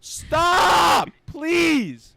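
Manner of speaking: high, drawn-out cry to stop; exasperated plea. Real, not acted. Begging the caller to stop.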